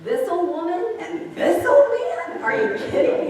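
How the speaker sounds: high, loud, slow disbelieving scoff; incredulous Sarah. Acted. Preacher voicing Sarah's loud, high incredulous scoff. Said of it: "I think she says".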